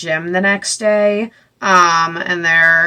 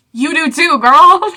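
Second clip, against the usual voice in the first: cheering; 8 semitones higher, 5 dB louder, slower than usual. Real, not acted. Cheering the character on.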